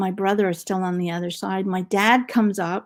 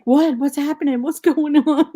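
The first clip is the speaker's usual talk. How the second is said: louder, higher bewildered dad; confused dad; 6 semitones higher, 7 dB louder than usual. Retold or imitated. Dad left on the train, asking in loud bewilderment. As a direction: play the lost father asking what happened. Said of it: "My dad is like".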